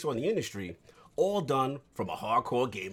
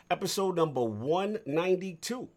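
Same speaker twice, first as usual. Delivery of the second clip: ordinary talk, nothing marked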